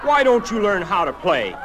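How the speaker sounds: high, loud heckle; indignant cry from the crowd. A narrator's reading. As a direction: shout down the bad player. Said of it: "cried still another".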